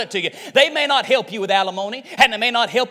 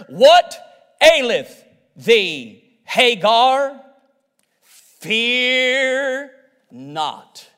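solemn scripture reading; much slower than usual. Acted. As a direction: stretch "Fear", then drop on "not". Reading God's words from scripture.

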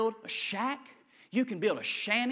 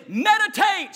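shouted call; 13 semitones higher, 11 dB louder, slower than usual. Shown as a demonstration. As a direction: belt Meditate high and loud for them to repeat. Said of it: "Say that word with me".

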